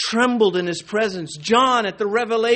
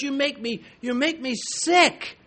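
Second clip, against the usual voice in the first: imitated divine rebuke; 8 semitones higher than usual. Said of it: "he says to this church".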